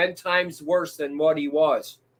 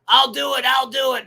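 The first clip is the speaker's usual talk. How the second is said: mock threat; 8 semitones higher, 4 dB louder than usual. Mocking streamer with a pen.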